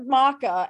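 "maca" said as mah-kuh; Kiwi mocha. Retold or imitated. Questioning a co-host's words, "maca" as mah-kuh. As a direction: imitate "maca" with an open ah. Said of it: "So you call it maca".

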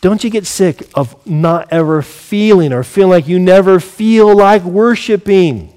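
normal talking voice

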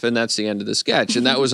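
ordinary talk

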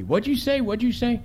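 very high-pitched indignant questioning; affronted mother. Acted. Mother challenging the cop's joke, high-pitched indignation. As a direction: demand it in a high woman's voice. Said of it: "she gets all blinky on me".

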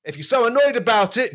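high, mock shouted retort; Jesus shouts back at roommate, mock high shout. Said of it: "Jesus shouted back".

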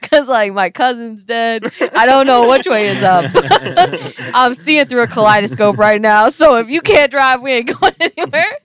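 high, loud and giggly; frantic tripping rant. Retold or imitated. Begging boyfriend to drive while tripping, loud giggly panic. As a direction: ramble on, wide-eyed and out of it. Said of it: "I was like".

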